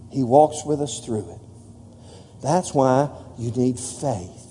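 soft, slow and hushed; quiet hush. Acted. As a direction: lean in and drop the voice to a near hush. Preacher drops to an intimate hush.

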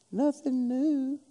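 soft, high, drawn-out singsong; little high voice. Shown as a demonstration. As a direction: lift it high and small, stretching the words. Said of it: "with a little high voice".

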